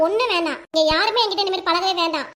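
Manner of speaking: normal talking voice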